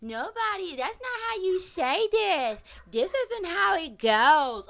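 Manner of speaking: whiny corrector voice, mocked; mocking know-it-all. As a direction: mimic a smug commenter correcting her. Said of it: "just say".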